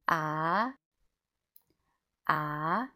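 "ah" gliding up like a question; rising second tone. Shown as a demonstration. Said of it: "sounds like the second tone".

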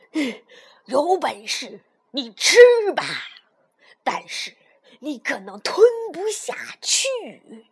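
loud, defiant grandpa toad; fierce roar. A narrator's reading. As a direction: dare the snake with forceful bursts. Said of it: "爷爷凶狠地吼道".

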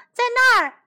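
high, loud call; shouting out. A narrator's reading. Animals show Millie and Molly the men, shouting. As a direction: holler to point someone out. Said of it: "动物们喊道".

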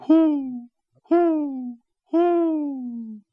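high, sustained owl hoots; long imitated owl calls. A narrator's reading. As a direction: hoot like Mother Owl, each call held high. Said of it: "turned her head toward the east and hooted".

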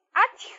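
high, sharp sneeze; comic wolf sneeze; loud sneeze. Acted. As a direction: sneeze with a cold. Wolf has caught a cold.

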